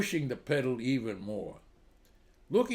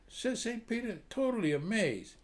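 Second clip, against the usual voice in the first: normal talking voice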